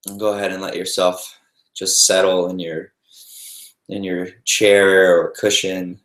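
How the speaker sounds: calm meditation guide with long, restful pauses; guided meditation. Real, not acted. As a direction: ease them gently into noticing the breath, unhurried.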